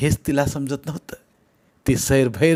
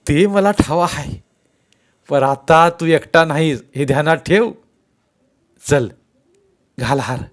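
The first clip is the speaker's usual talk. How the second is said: lightly voiced elder, raised and louder; hearty sarpanch; 4 semitones higher, 6 dB louder than usual. A narrator's reading. Sarpanch answering Govinda, hearty and emphatic. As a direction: agree heartily, then give the order. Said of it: "sarpanch दिलखुलास हसून म्हणाले".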